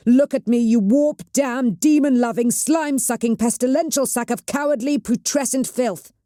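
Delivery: high, loud furious insults; raging tirade. A narrator's reading. Cheta raging at the cowering witch, furious high tirade. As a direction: spit every insult at her. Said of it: "Cheta raged".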